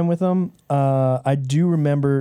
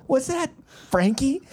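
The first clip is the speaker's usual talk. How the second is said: higher put-on voice for the wife; old wife voice; 9 semitones higher than usual. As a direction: answer like a doting old wife. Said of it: "What are these voices?".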